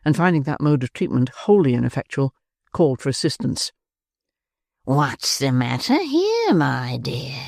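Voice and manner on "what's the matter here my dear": put-on sly old man peering in. A narrator's reading.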